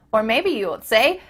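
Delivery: usual speaking voice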